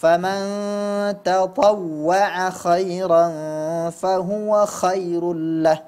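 slow chanted verse, long nasal hums, clear KH in KHAIRAN; clear kha recitation. Shown as a demonstration. Quran teacher demonstrating kha, slow recitation. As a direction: recite slowly and stretched, sounding KHAIRAN fully. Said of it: "pelafalan huruf kha pada".

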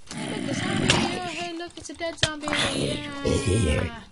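hammed-up delight at a dead zombie; squealing like a thrilled kid over nothing; mock excitement.

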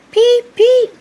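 two very high, loud peeps; piercing toy-engine "peep, peep"; engine whistle peeps. Acted. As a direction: imitate a little train whistle. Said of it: "peeped Percy".

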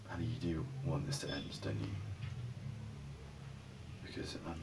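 hushed, fragile whisper. Acted. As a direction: barely breathe the question out. Tense breakup question, whispered.

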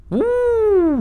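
a sudden high excited uh; surprised yelp. Real, not acted. Gamer spotting loot underwater, high surprised yelp. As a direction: squeal at the find.

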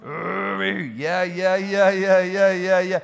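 loud, high joyful yeahs; exuberant. Real, not acted. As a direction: burst out in joy. Exuberant outburst during prayer.

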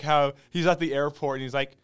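ordinary talk

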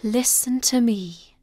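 whispered spirit voice; gentle whisper. Acted. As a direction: well spirit whispering to the weeping woman. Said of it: "heard a gentle voice say".

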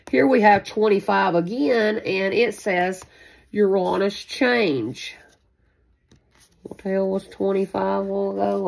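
ordinary talk, nothing marked

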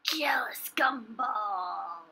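slow, hushed whisper; Jealous Gumball. Acted. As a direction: whisper it low, drawn out. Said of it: "Or whatever he sounded like".

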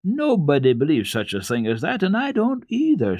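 put-on firm, high girl voice rising at the end; very decidedly. A narrator's reading. Girl firmly refusing to believe the boy. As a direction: settle the matter firmly. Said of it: "said Heidi very decidedly".

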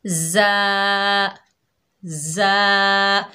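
जा said hard and stretched; a firm, held जा; जा with a strong z. Shown as a demonstration. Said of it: "इसे पढ़िए".

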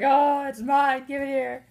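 high, loud, slow possessive shout as the cat; mock shout, claiming the toy; greedy cat voice. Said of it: "He's like".

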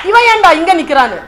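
loud, high, agitated demand; acted flustered outburst. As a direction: demand it in rising alarm. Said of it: "பதட்டம் ஆயிட்டாரு".

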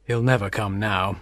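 low, quiet, sorrowful voice; acted downcast father giving up hope; resigned father. A narrator's reading. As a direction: let the voice sink low and soft in defeat. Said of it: "He felt very sorry for her".

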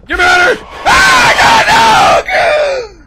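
very high, loud desperate shriek; terrified screaming. Real, not acted. Player trapped with the monster, terrified screaming. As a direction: scream to be let out.

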